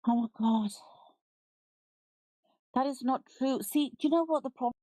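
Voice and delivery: ordinary delivery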